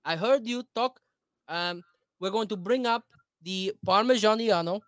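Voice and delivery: drawn-out, put-on Italian cook's accent; very Italian American accent. Shown as a demonstration. Food show host introducing a recipe, mock Italian accent. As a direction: play up the Italian accent on every word. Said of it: "you're an Italian American, very Italian American".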